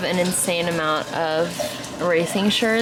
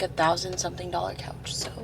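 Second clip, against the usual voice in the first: whispered aside; whispering; 2 semitones lower, 10 dB quieter than usual. Real, not acted. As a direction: whisper it like a secret. Confiding the couch price.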